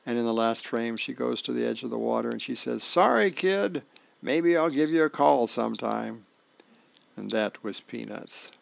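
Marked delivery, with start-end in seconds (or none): 2.8-6.2 calling out. Acted. Girl calls out over the ocean.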